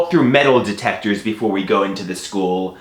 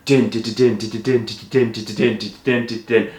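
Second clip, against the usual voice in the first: rhythmic din-din clatter; train noise; 3 semitones lower, 3 dB quieter, faster than usual. Retold or imitated. Storyteller taking the train home, imitated train rattle. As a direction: chug along like wheels on rails.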